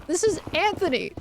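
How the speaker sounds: Anthony with a clear TH, pitched high; correct pronunciation. Shown as a demonstration. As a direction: demonstrate Anthony as "An-thuh-nee". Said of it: "That's how you pronounce it".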